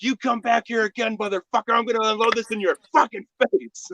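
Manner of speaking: high-pitched retold shouting threat; acted furious threat. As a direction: threaten the man as he takes off. Acted threat at fleeing intruder.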